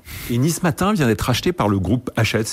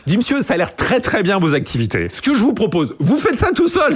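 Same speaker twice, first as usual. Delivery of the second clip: mock boss voice, pitched higher and climbing; amused boss on the phone; 8 semitones higher than usual. Caller retelling the new boss brushing him off, mock higher voice. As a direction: play the Nice Matin boss as a grinning caricature, lightly.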